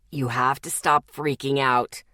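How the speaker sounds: low male growl; a firm low warning growl. Acted. Zeke growls at the panicking Lexi, low and firm. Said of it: "he growled against my neck".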